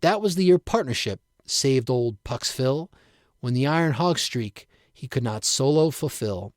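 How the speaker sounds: ordinary delivery, nothing marked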